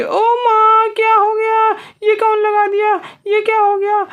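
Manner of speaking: high, squealing witch crying out in shock; stricken witch. Pretend. Injected witch cries out in shock, high squealing witch voice.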